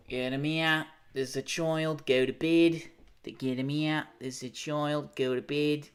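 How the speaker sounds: broad Aussie put-on, ordering someone off; Aussie accent. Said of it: "Is that a good Aussie accent?".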